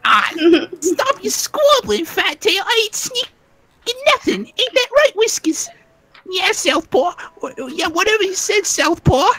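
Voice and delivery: very high, squeaky rat voice, defensive; squeaky rat creature. Acted. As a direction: squeal it out like a cornered rat man. GM voices second squabbling rat.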